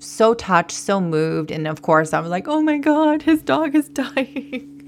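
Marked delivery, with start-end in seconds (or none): 2.5-4.8 higher, half laughing, half crying; choked-up disbelief. Retold or imitated. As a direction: laugh through tears at the memory. Retelling her reaction to dying dog.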